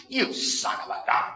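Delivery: hunched, pointing, harsh and unvoiced; hissed accusing son of a gun; scowling insult. Shown as a demonstration. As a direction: spit it out with a scowl. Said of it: "Or you can use the same words".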